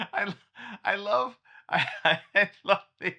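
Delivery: high, loud giggling through the words; bursting into delighted laughter; laughing. Real, not acted. Reacting to his AI baby picture, laughing loudly.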